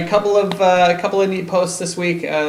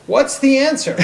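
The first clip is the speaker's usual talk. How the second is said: mock exasperated; 11 semitones higher, 6 dB louder than usual. Comic repeat of question.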